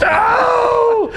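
loud, high-pitched wail of "oh"; mock anguished cry. Comic telling of a traumatic shower, mock anguished cry. Acting out crying while scrubbing.

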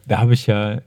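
ordinary talk, nothing marked

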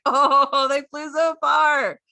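a hint of a kid in awe; mock child's wonder; amazed kid. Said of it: "He's like".

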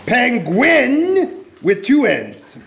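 slow, high, deliberate spelling. Shown as a demonstration. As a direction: spell each letter out slowly. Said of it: "that was Club with a K".

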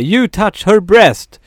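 hög, utdragen låtsasanklagelse; härmad trucker. Retold or imitated. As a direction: peka ut honom, starkt och långsamt. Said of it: "pekar och säger". (högljutt härmad trucker som anklagar)

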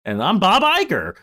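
high, loud mock CEO rant; hammed-up executive sounding off at investors; Bob Iger. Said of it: "this is my Bob Iger impression".

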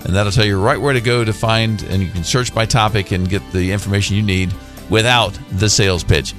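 usual speaking voice

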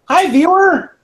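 high, loud cheery hello; cheery greeting. Real, not acted. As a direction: chirp it brightly to the viewer. Greets viewer on stream.

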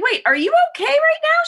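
high-pitched alarmed check; retold alarm. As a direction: question an injured mother in high alarm. Retold worried question after accident.

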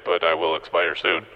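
ordinary talk

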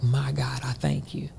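soft, hushed thanks to God; grateful whisper. Retold or imitated. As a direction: whisper your thanks, overcome. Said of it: "It was just like".

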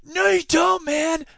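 put-on high-pitched, over-eager young heckler; easily excited youngster. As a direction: butt in as an excitable kid. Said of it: "easily excited young person".

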